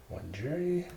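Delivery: usual speaking voice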